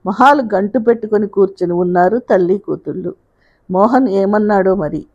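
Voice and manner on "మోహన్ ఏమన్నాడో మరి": ordinary delivery, nothing marked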